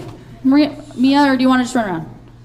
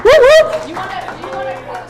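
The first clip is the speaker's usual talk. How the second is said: voice cracking; 9 semitones higher than usual. Real, not acted. Teacher cheers on a kid and her voice cracks. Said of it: "ooh, whoa, I just cracked".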